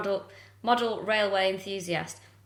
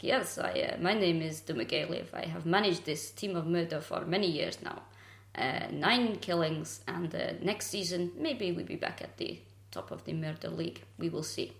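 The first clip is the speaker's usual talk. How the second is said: subdued football-manager voice in a mock foreign accent; generic Eastern European accent, interview style; generic foreigner accent; 2 semitones lower, 5 dB quieter than usual. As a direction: play a foreign manager giving a measured post-match interview. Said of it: "it's my generic foreigner impression".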